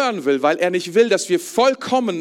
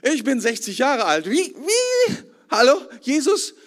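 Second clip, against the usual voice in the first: baffled Nikodemus; 6 semitones higher than usual. Retold or imitated. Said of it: "Nikodemus hat null Plan".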